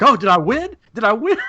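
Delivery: giddy hope of winning a prize; excited. Retold or imitated. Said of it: "I'm like".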